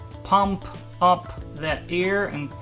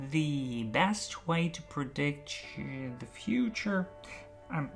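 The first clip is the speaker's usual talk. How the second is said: soft, slow, careful phrase; slow practice; 5 dB quieter than usual. Shown as a demonstration. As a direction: practise it slowly and quietly. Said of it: "increase the pace gradually".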